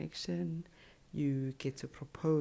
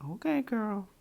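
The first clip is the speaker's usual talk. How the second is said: sassy approval; 7 semitones higher, 5 dB louder than usual. Real, not acted. Reacting to a contestant's quote.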